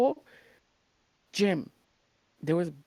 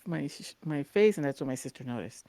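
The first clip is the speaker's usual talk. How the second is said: ordinary talk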